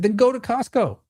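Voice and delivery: high, sharp brush-off; a fed-up high-pitched get-lost; exasperated dismissal. Retold or imitated. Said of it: "That's exactly what I said, just like that".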